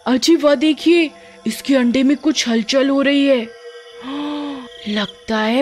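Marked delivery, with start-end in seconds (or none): 0.1-3.5 higher-pitched, eager alert to her husband; mildly excited wonder; excited wife. Acted. Wife spots the egg moving. 4.0-4.6 soft intake of breath in surprise; quick amazed gasp; startled gasp. Acted. Wife sees the egg stirring.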